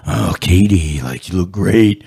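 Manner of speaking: low-pitched hammed-up sweet talk; mock sweet talk. Wrestler wooing a woman at the funeral home, mock sweet talk. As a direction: croon low, as if wooing her in jest. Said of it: "like, all sweet talking".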